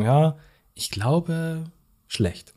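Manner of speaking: drawn-out, unsure answer starting high and dropping; hesitant guess. Acted. Host voicing the listener's answer about potatoes, hesitant. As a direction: think it over slowly, then guess.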